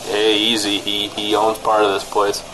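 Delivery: normal talking voice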